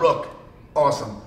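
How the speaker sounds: normal talking voice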